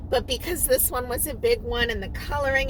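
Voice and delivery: ordinary delivery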